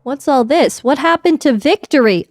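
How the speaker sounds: ordinary talk, nothing marked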